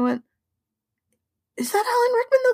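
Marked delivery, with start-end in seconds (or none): 1.6-2.5 much higher-pitched puzzled voice; retold puzzlement. Host retelling first doubts about the actor's voice, high-pitched puzzlement.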